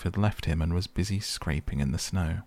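ordinary delivery, nothing marked

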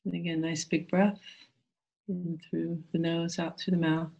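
calm, gentle meditation guide. Acted.

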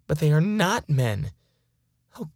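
ordinary delivery, nothing marked

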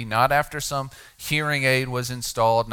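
normal talking voice

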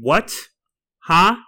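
sharp, high, loud query; abrupt loud demand to repeat; blunt loud response. Acted. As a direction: fire it back loudly. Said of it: "I'll just respond".

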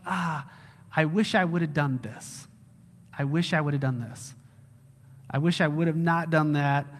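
low, soft, sighing regret; mild regret. Acted. Barely saved believer seeing Jesus, quietly regretful. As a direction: lament it quietly, mildly acted. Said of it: "instant regret".

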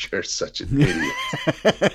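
high, drawn-out, laughing through it; laughing wife. Retold or imitated. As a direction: imitate her laughing scold, high and slow. Wife reacting through tears to joke.

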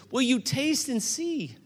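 ordinary talk, nothing marked

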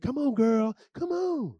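coaxing, rising up high; mock begging. Imitating people who beg you to come in, coaxing. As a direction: plead like someone coaxing a friend. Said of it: "where people beg".

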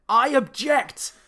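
high, slow, drawn-out courtroom shout; loud objection. Retold or imitated. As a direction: bellow the objection like a scene-stealer.

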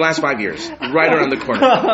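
laughing mock repeat. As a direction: say it through laughter. Mocking repeat of friends.

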